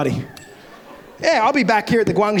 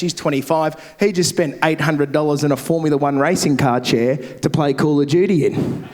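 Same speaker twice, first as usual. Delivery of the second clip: usual speaking voice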